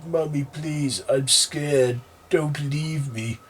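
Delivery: put-on frightened child begging mother; scared begging. A narrator's reading. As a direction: beg like a frightened boy. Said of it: "him crying and begging her to come".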